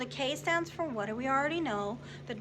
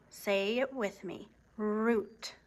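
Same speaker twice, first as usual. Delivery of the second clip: normal talking voice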